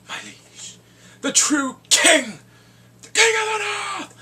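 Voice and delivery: high, loud, slowed royal proclamation; proclaiming the king. Retold or imitated. Kid hailing Jon as king of the north, loud and high. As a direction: hail the true king. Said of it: "and he sees".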